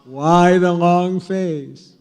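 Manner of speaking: joke bartender. Acted. Bartender greets the horse that walked into the bar, slow joke punchline. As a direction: stretch out the bartender's question for the laugh. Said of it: "the bartender says".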